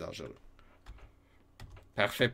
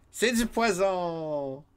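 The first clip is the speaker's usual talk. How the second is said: dramatic read; 6 semitones higher, slower than usual. Pretend. Reading game text dramatically.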